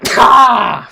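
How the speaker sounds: loud high shout of alarm; startled yell. Real, not acted. Sudden loud cry at a chat message. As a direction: yelp at the screen.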